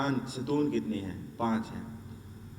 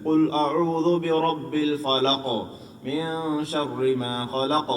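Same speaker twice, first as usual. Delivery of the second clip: slow Quran recitation; 3 dB louder, much slower than usual. Shown as a demonstration. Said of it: "یہ ق کیوں sound ہے".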